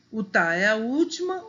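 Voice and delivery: ordinary talk, nothing marked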